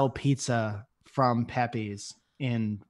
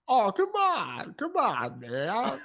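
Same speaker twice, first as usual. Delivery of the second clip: slow, higher laid-back drawl; Jeff Bridges impression; 7 semitones higher, slower than usual. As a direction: stretch it out like a mellow cowboy. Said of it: "Jeff Bridges impression".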